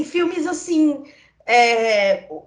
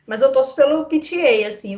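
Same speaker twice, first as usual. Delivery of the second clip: usual speaking voice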